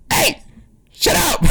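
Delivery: sharp high mock yell at the dogs. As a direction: bark it at the dogs, half joking. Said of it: "you yelling at your dogs".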